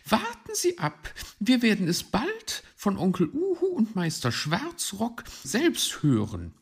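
high-pitched magpie telling him to wait; retorting magpie. Acted. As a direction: answer back firmly and high.

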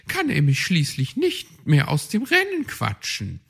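high, cheeky magpie challenging; high and sly, daring her rival. Acted. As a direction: Frau Elster proposing a duel on ice. Frau Elster challenges Luzi.

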